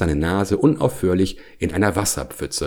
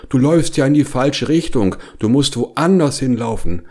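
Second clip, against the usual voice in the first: calling after someone; 7 semitones higher, 4 dB louder than usual. A narrator's reading. Calls after friend running wrong way.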